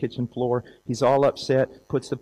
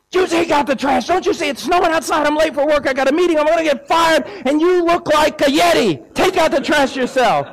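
high, loud hammed-up yelling husband; angry shout; 8 dB louder than usual. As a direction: fire it back at her, outraged. Husband yells back at wife.

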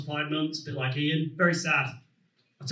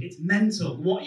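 usual speaking voice

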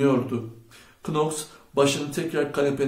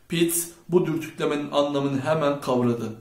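plain reading voice

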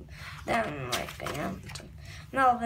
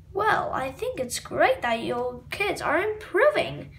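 ordinary delivery

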